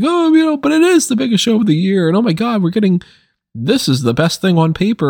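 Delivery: high-pitched mock fan enthusiasm, easing down; gushing good fan. Host mimicking a good fan hyping the show, mock gushing. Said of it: "quote unquote good fan".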